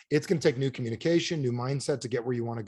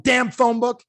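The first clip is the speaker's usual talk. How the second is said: loud, higher-pitched exasperated curse; angry outburst; 8 semitones higher, 8 dB louder, slower than usual. Acted. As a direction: curse it as you hurl it down. Throws phone book down angrily.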